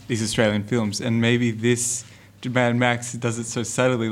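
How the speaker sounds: normal talking voice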